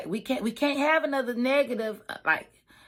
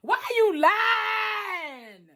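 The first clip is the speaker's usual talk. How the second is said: high, slow, drawn-out yell; incredulous shout; 11 semitones higher, much slower than usual. Retold or imitated. Yelling at the camera at the coworker's lying, high and slow. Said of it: "I'm looking at the camera like".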